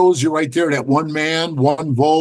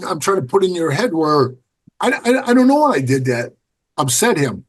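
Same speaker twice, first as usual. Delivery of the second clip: ordinary delivery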